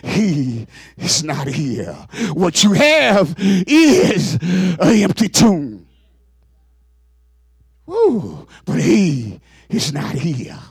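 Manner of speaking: fervent preacher proclaiming; preaching. Acted. Preacher proclaiming the empty tomb to the congregation, fervent. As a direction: proclaim it from the pulpit.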